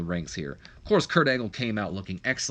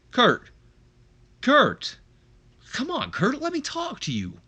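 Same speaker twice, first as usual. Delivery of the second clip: raised, high-pitched call after someone; 13 semitones higher, 5 dB louder, slower than usual. Acted. Older brother chasing his brother down the entryway, calling out. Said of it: "tries to catch up and shout out".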